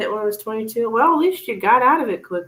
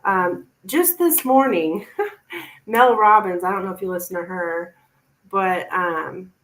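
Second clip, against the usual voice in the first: ordinary talk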